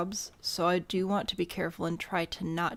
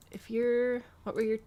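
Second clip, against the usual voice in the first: ordinary talk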